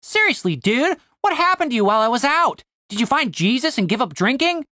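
high, needling disbelief; Tim, incredulous. Acted. Tim taunts Cooper over drinking, incredulous.

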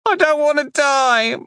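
put-on very high, loud tearful child plea; a boy sobbing for his life. A narrator's reading. Boy sobbing to the sorcerer, high desperate plea. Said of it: "his sobs became more energetic".

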